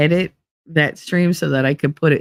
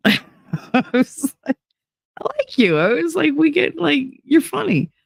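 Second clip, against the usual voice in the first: laughing; 11 semitones higher than usual. Real, not acted. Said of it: "laughing while quoting herself".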